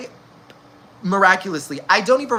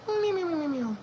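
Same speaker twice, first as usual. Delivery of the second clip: meow; 11 semitones higher, 7 dB quieter than usual. Pretend. Playful noise going to bed.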